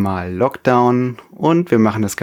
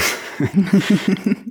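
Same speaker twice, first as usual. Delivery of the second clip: amused laugh; 9 semitones higher than usual. Real, not acted. Laughs at digital opening.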